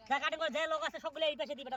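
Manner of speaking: high, loud shouted order; shouting. Real, not acted. Worker ordering the cutting crew to one side, shouting. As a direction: holler the instruction to the whole crew. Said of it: "চিল্লাইস না তুই".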